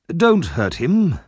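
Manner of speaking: slow, high, drawn-out plea; pleading old gentleman. A narrator's reading. Old gentleman to policeman about Oliver, pleading. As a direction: plead slowly for the boy.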